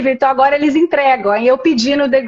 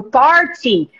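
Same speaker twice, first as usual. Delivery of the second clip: "party" in a British accent, open o and clear t. Shown as a demonstration. A student demonstrating "party" in a British accent. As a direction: say "party" like a Brit. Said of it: "Fala igual um britânico".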